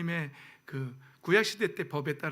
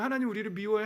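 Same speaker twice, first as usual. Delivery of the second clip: usual speaking voice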